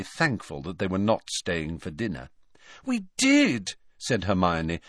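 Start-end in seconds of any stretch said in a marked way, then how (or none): 2.8-3.7 very high, pleading insistence; earnest protest. A narrator's reading. Hermione insisting to sceptical Hagrid, high earnest protest. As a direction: insist you mean it.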